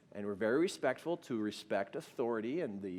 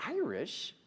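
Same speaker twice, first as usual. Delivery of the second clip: high, puzzled question; retold bewildered husband, pitched up; baffled echo; 6 semitones higher than usual. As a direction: repeat it in disbelief. Husband baffled by wife's claim.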